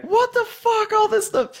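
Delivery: squealing mock yell of an angry drunk friend; retold scream. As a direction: shriek it way up high, imitating her. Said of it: "she just starts screaming at me".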